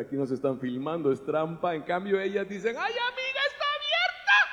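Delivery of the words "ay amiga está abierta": put-on much higher, excited squeal of a gossiping girlfriend; high mock squeal, tomb is open. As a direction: gasp it out like a thrilled friend. Women find tomb open.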